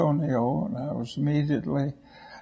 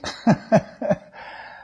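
breathy laugh; 8 semitones higher than usual. Real, not acted. Laughs at own bad luck.

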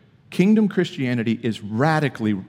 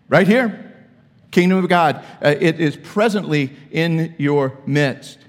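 louder, higher preacher declaration; preacher answering the Pharisees, loud and emphatic; emphatic preaching; 6 semitones higher, 6 dB louder than usual. Acted. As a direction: proclaim it to the room.

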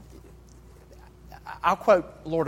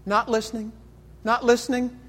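high-pitched stubborn denial; childish refusal; 8 semitones higher, 3 dB louder than usual. Acted. Creature covering his ears against his darker self, high childish refusal. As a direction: blot out the other voice, pitched up high. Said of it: "he covers his ears and says".

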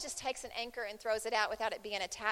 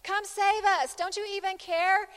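high, loud panicked plea for rescue; panicked yelling; 8 semitones higher, 5 dB louder than usual. Retold or imitated. Disciples yelling at Jesus in the storm, panicked and loud. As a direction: cry out in terror to a sleeping master. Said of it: "and they're yelling".

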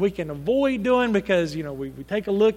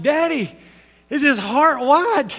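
higher, insistent retold child's question; badgering child, imitated; 5 semitones higher, 6 dB louder than usual. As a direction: pester like a little girl. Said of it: "she kept badgering me".

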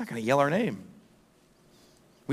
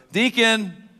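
raised, higher shout of a name; calling out to son; 10 semitones higher, 7 dB louder than usual. Retold or imitated. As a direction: call your kid across a crowd. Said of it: "Then I'll go".

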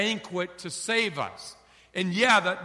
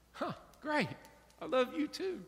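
higher, softer, sighing "Huh, great"; resigned wife; 8 semitones higher, 5 dB quieter than usual. Retold or imitated. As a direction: give up with a high little sigh.

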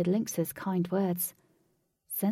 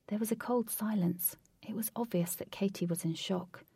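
ordinary delivery, nothing marked

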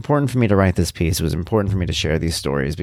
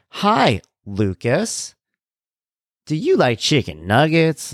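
toy dog voice; 7 semitones higher, slower than usual. Retold or imitated. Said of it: "he'll be like".

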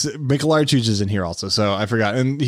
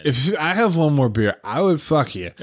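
put-on drunk guard voice; 4 semitones higher than usual. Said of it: "it's like".

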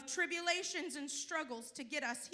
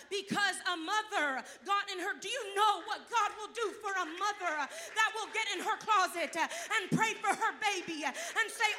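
loud, high, building preacher's cry; rising fervour, driving the point home; preaching at full force; 6 semitones higher, 12 dB louder than usual. Acted. Preacher stirring the church about praying mothers, full force.

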